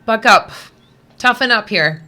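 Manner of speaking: loud, firm rallying order; pep talk. Retold or imitated. Reader telling the little dogs to buck up, rallying. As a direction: tell them to toughen up. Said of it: "I'm just like, "Come on".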